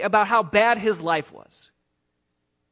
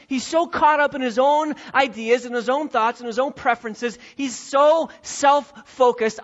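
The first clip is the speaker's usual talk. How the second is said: louder, higher, stressing so; building preaching; 6 semitones higher, 5 dB louder than usual. Real, not acted. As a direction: drive the point home with fire. Preacher rising to emphasis.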